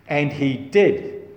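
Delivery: ordinary talk, nothing marked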